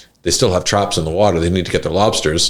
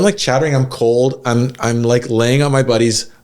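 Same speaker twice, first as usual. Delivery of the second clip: usual speaking voice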